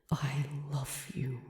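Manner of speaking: soft whispered I love you; a private confession whispered in his head; inner whisper. Acted. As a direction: barely breathe it, a secret thought. Luke's unspoken inner thought.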